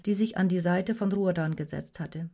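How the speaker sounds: ordinary delivery, nothing marked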